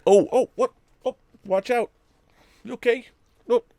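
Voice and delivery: high laughing concern for the dog; surprised pet talk. Real, not acted. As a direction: caught off guard by the dog. Dog stumbles, owner laughs.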